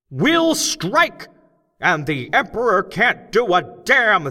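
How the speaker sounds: loud, high, slow speechmaker shouting to a cavern crowd; loud, high, slow rally speech; rousing oration. A narrator's reading. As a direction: whip up the crowd of gnomes, big and deliberate. Crockett's strike speech.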